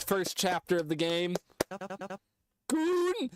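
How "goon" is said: high shouted call of Goon; shouted character call. Pretend. Character calls out to his partner, high shout. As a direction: yell Goon up high, bright and sudden. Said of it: "I'm not doing a British voice".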